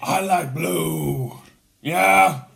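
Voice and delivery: very slow, drawn-out film-star voice; Al Pacino impression. Answering the kit question as a star, slow Pacino impression. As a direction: draw every word out like a screen legend. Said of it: "as Al Pacino".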